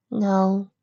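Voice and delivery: high, small filly voice, sad; sad murmur. A narrator's reading. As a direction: murmur it as a downcast little filly. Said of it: "Lucy murmured sadly".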